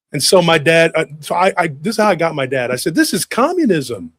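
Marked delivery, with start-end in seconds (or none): 3.0-4.1 high, slow declaration of injustice; indignant accusation. Retold or imitated. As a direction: pronounce it like a damning charge. Kid argues against sharing.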